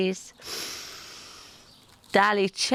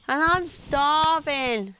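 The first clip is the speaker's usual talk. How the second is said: higher, slow hungry dinosaur voice; Dally, slow and higher; 5 semitones higher, slower than usual. Acted. Dally tells Sid he is hungry, slow and high. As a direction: draw it out like a starving little dinosaur. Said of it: "said Dally".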